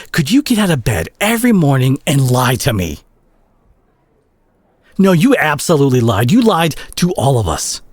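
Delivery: betrayed friend yelling, rising to the top of the voice; furious accusation. Acted. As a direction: tear into a friend who lied to you. Confronting a friend who lied.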